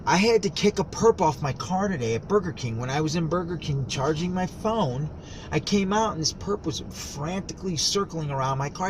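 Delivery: normal talking voice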